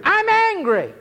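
I'm angry flung out high-pitched; high angry cry. Real, not acted. Preacher declares his anger.

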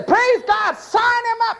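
very high, hammed-up mock cheer; gleeful evangelist. Modern evangelists welcome a rich volunteer, mock jubilation. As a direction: crow it like an over-eager recruiter.

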